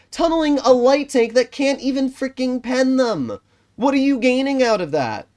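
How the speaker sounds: high-pitched, exasperated rant; raging. Real, not acted. Gamer ranting at players who target his light tank, high and angry. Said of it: "It's funny to watch me rage".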